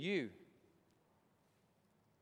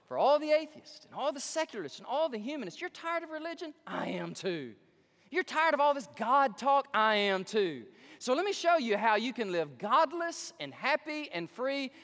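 loud, higher pitched sales pitch; radio pitchman; 7 semitones higher, 6 dB louder than usual. Retold or imitated. Preacher mimicking the atheist commercial, loud sales pitch. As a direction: sell it like an ad read.

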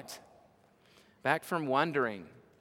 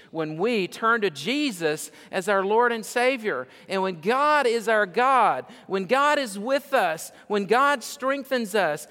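rising, louder preaching voice, climbing high; preaching at full force; 7 semitones higher, 4 dB louder than usual. Acted. Preacher rallying his congregation against fear, rising. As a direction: lift the church toward the climax.

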